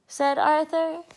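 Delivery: usual speaking voice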